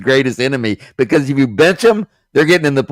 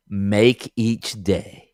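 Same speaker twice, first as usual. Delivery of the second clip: slow, much softer, savouring each word; reverent quote; 4 semitones lower, 8 dB quieter, much slower than usual. Retold or imitated. Cohost reciting John Wooden's best quote, slow and hushed. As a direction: lay it down gently like a proverb.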